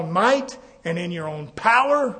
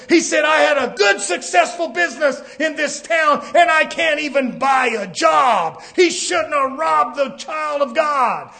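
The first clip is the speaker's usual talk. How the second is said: raised, driving pulpit delivery; fervent preacher building a list; full-force preaching; 7 semitones higher, 5 dB louder than usual. Real, not acted. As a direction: hammer out each phrase at a high pitch.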